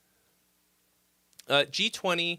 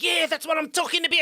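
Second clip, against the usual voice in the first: high, fast, unhinged South African whoop; hammed-up psycho mercenary cheering; mimicking Sharlto Copley; 13 semitones higher, 4 dB louder, faster than usual. Imitating the Elysium mercenary, a high, fast whoop. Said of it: "he's like".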